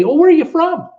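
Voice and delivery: high, bright mock local asking, voice lifted well up; imitating a Caymanian. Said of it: "And they'd say".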